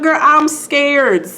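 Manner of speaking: high, drawn-out mock fear; playful fright. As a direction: exaggerate being scared, stretching the words. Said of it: "it freaks her out".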